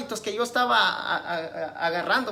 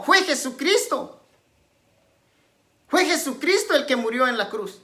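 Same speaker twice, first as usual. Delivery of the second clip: forceful rhetorical questioning, raised pitch and volume; prédica enérgica; 6 semitones higher, 6 dB louder than usual. Real, not acted. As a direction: challenge the congregation like a preacher. Predica con preguntas retóricas. (predicando con vehemencia)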